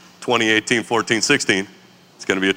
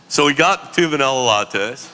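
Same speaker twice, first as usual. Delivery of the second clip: ordinary talk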